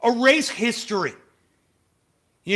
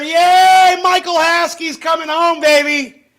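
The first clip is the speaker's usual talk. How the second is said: much higher and louder, a real burst of joy; excited cheer; 7 semitones higher, 7 dB louder, slower than usual. Real, not acted. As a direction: cheer the good news out loud. Good news about Michael.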